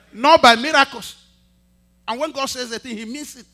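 loud, high preaching. Acted. Pastor scolds the church for ignoring the word, loud. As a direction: hammer the point home loudly.

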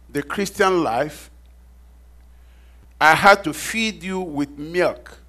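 slow, loud, emphatic Bible reading; scripture reading. Acted. Pastor reading First Corinthians to the church, slow and loud. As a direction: read Paul's letter out slowly and with weight.

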